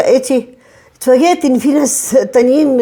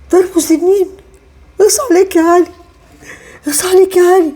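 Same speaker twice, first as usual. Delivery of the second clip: pitched-up pleading cry to her absent son; anguished lament; 6 semitones higher than usual. Acted. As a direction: call out to Ali in grief. Mother laments son selling flat.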